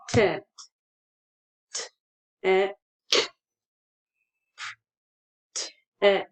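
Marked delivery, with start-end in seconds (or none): 1.7-6.3 T broken off from "et", with a pause between; segmented sounds. Shown as a demonstration. Teacher sounding out the word's end, segmented sounds. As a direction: sound out "T-, et" in separate pieces. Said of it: "that's the t sound".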